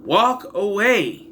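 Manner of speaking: high, emphatic exasperation at a film character; exasperated. Acted. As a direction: plead with the screen in sheer disbelief. Exasperated advice shouted at film character.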